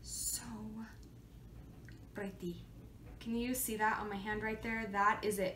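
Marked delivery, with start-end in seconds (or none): 0.0-2.6 hushed, breathy admiration; whispered awe. Real, not acted. Showing viewers the swatch, hushed whisper. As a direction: whisper it like a secret.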